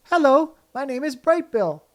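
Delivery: high, bright little gosling voice; a small proud gosling announcing his name. A narrator's reading. Gosling greeting anyone who will listen, high and proud.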